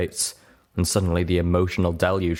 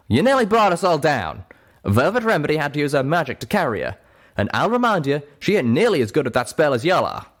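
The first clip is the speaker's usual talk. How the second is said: angry scolding; 8 semitones higher, 5 dB louder than usual. Acted. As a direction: lay the blame on. Calamity continues scolding.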